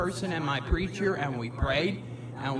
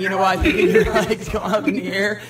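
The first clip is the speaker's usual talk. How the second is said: laughing; 3 semitones higher, 7 dB louder than usual. Real, not acted. Laughing while recalling the meeting.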